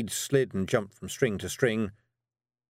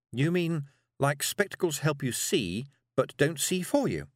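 put-on higher, curious girl; 6 semitones higher than usual. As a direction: work it out like a child. Tiffany asks.

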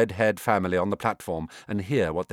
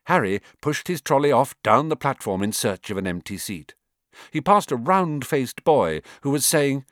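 plain reading voice

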